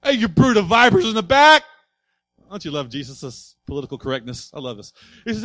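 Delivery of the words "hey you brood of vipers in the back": high, loud calling-out rebuke. Acted. Jesus rebuking Pharisees in the corner, loud calling shout. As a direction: shout it at the back row.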